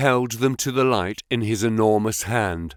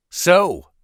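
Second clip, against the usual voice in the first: a sharp, raised "So"; furious; 7 semitones higher, 5 dB louder than usual. A narrator's reading. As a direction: spit it out in anger. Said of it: "with a furious glance".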